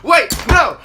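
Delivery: sudden high, loud protest; yelling wait no at the dean; retold shout. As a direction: leap in with a panicked shout of no. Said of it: "I'm like".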